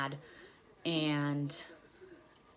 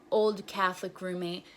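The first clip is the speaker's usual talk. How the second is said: ordinary delivery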